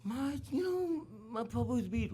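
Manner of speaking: high, halting flustered reply; flustered wife. Retold or imitated. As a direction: stumble for words in front of the guru. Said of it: "she was just mumbling and fumbling".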